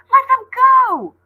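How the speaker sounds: high, loud, slow cry to free her friends; girl crying out as her friends are seized; desperate shout. A narrator's reading. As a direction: yell it with everything she has. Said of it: "Ollie cried".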